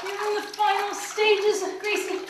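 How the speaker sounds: high, bright praise for the cat; encouraging praise. Real, not acted. Owner praises Gracie during shampoo, high encouraging.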